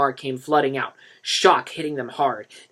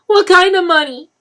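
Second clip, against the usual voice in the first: pressing; 15 dB louder than usual. A narrator's reading. Mother presses Denny.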